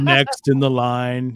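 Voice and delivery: slow, laughing bored clerk; flat weary sighing clerk. Retold or imitated. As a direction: drag it out like a tired clerk. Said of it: "the worst impression of a D M V clerk".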